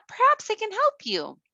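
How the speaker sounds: put-on tiny girl offering to help; little girl voice.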